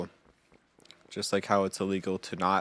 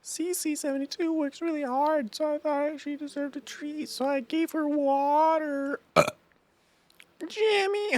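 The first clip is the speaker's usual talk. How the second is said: put-on girl voice. Game girl greeting the player, high falsetto girl voice. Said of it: "My girl voice is the exact same".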